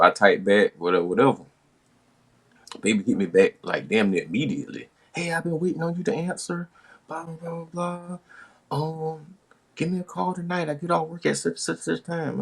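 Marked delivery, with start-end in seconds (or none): 5.1-12.4 high, soft sweet woman's voice; girl's voice. Retold or imitated. Voicing the girl, high soft.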